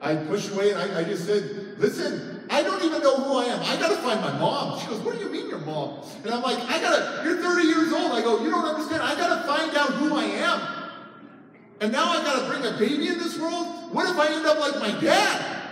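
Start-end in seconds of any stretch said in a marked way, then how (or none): 1.8-4.8 high, urgent acted pleading; agitated. Retold quarrel with pregnant wife. 5.0-6.0 baffled. Retold or imitated. Wife's baffled reply in quarrel. 6.7-15.6 high-pitched acted desperation; agitated. Acts out heated argument with wife.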